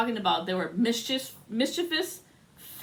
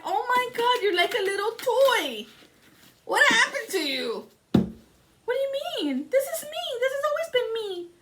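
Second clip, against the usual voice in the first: much higher, tiny squeaky doll voice; high little toy voice; 13 semitones higher than usual. Retold or imitated. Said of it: "she was talking like this".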